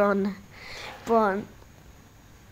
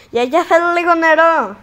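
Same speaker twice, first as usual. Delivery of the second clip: calling out; 6 semitones higher, 8 dB louder than usual. Real, not acted. Calling grandma from couch.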